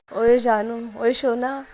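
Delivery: loud, doting pet names; affectionately wooing her sulking friend back; sweet coaxing. A narrator's reading. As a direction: coax her out of a sulk with sweetness. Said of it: "एकदम प्यारे प्यारे words बोल रही होती है".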